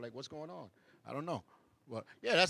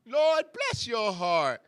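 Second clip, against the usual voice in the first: "bless" stretched slow, higher and louder; sarcastic bless your heart; 7 semitones higher, 7 dB louder, much slower than usual. Shown as a demonstration. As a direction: drawl "Lord, bless your heart" with mock pity. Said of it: "but it is kinda sarcastic".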